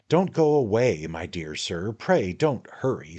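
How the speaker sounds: high, wheedling man-of-the-world patter; a hint of a knowing little lawyer; sly attorney, coaxing. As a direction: draw him in like a confidant. The attorney talks in the story.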